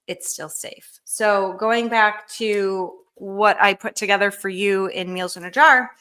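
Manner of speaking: ordinary talk, nothing marked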